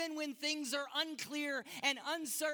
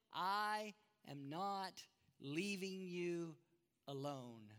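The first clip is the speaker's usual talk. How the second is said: slow, soft, the last word hushed; Jesus promising tenderly; 5 semitones lower, 4 dB quieter, slower than usual. Acted. Jesus softly promises the disciples he will not leave them. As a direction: linger on it like a final vow. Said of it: "Jesus says to them".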